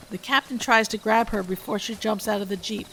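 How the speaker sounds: ordinary delivery, nothing marked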